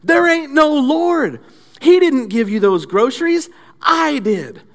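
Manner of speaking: high loud triumphant gotcha voice; gloating atheist. Acted. Atheist neighbor gloats at old lady from the shrubs, high crowing. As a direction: jump out and crow over her. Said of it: "Then he says".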